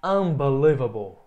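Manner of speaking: teacher modelling English. Shown as a demonstration. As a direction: announce "Unbelievable" clearly for the class. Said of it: "a palavra unbelievable".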